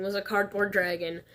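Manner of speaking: normal talking voice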